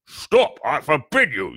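high, loud frantic order; panicked yell. A narrator's reading. Uncle tries to silence the giant, panicked. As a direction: yell to cut him off. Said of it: "yelled Uncle Vernon in panic".

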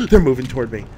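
fast, panicked gasp. Real, not acted. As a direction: blurt it in panic. Creatures approach, louder.

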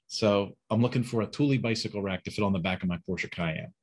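usual speaking voice

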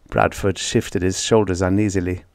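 plain reading voice

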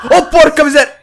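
high, loud frightened shriek; a real jolt of fear, yelling; panicked scream. Real, not acted. Chased, panicked outburst. (urlo acuto di paura)

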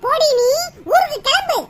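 furious sister ordering her to go home; angry order. Acted. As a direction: throw her out of the house.

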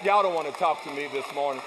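low teasing aside. Real, not acted. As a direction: toss it off under your breath. Preacher's aside to congregation.

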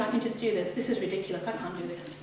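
low, hushed talking to yourself; retold self-doubt. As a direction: think aloud quietly, losing heart. Retold private doubts on ferry.

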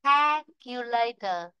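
"Calculator" drawn out, CAL stressed, "later" falling; slow careful model. Shown as a demonstration. Teacher re-reads for students, slow and segmented. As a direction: say "Calculator" slowly in separated syllables. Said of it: "Để đọc lại này".

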